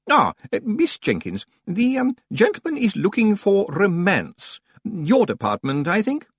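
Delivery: high, halting, polite voice; hesitant senior librarian. Acted. Senior librarian passing customer to assistant, high and hesitant. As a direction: hand over delicately, pitched high.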